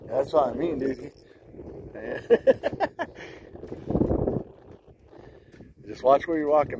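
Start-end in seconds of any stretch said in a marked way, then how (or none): 1.6-4.4 high breathless laugh. Real, not acted. Fish on the line.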